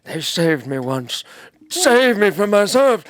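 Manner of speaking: put-on grand old wizard getting carried away; Gandalf impression. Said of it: "That's my Gandalf".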